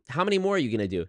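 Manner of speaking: ordinary talk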